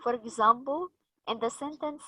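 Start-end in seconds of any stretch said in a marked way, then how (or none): none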